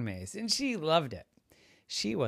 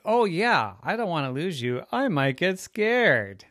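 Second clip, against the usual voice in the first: worried mommy; 7 semitones higher, 6 dB louder than usual. Acted. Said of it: "And Mommy said".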